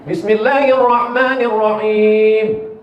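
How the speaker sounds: Bismillahirrahmanirrahim drawn out slowly in chanted tajwid; slow Quran recitation. Shown as a demonstration. Preacher demonstrating recitation to the congregation, slow and chanted. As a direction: recite it slowly, every letter clear. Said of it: "Berapa kesalahan orang selalu salah".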